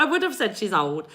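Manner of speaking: ordinary delivery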